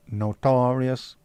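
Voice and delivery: Notorious stretched very slowly; drawn out. Shown as a demonstration. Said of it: "repeated word".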